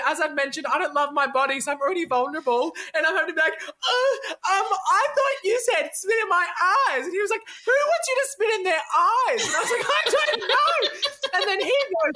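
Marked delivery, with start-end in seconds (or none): 3.6-7.0 high-pitched, hesitant retelling; awkward explanation. Retold or imitated. Naked, vulnerable partner explaining the mishearing, awkward high voice. 7.6-9.5 high, laughing mock disbelief; incredulous. Boy baffled by partner's excuse, incredulous high-pitched retort. 9.9-10.7 very high laughing squeal; flustered defence. Retold or imitated. Embarrassed partner defending the mistake, high flustered squeal.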